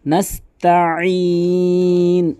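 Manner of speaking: নাস্তাঈন said slowly with pressure on the word; slow pressed নাস্তাঈন; stressed correctly. Shown as a demonstration. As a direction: lean on নাস্তাঈন, slow and firm. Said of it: "এভাবে চাপ দিয়ে বলবেন".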